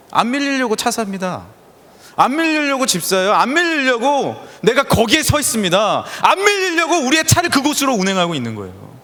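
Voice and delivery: 안 밀리려고 hammered like a sermon crescendo; building preaching refrain. Acted. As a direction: drive the refrain harder each time.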